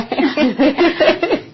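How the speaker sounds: a short, high burst of laughter; real laugh. Real, not acted. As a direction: laugh at your own joke. Laughs at own joke.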